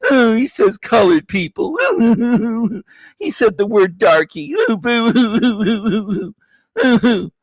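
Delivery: high whiny voice with laughs; sob-whine through laughter; mock crybaby whine.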